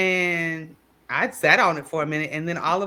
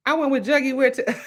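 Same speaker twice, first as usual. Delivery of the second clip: high, fast teasing quote; lightly mocking a friend's claim; 10 semitones higher, 4 dB louder, faster than usual. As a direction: toss it off high and quick, poking fun. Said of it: "She's saying".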